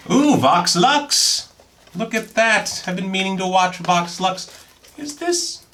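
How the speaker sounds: high delighted squeal; excited. Real, not acted. Spotting a film he wanted, high excited gush. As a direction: gush over the find.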